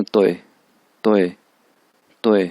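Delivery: तोए with a falling pitch; fourth tone. Shown as a demonstration. Teacher demonstrating fourth tone on तोए, falling tone. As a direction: drop the pitch through तोए. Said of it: "उस पे four tone है".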